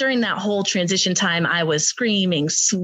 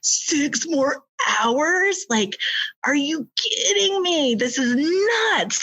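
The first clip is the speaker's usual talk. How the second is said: high, slow disbelief; are-you-kidding-me outrage, drawn out; mock shock at the wait; incredulous; 6 semitones higher, slower than usual. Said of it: "because I'm like".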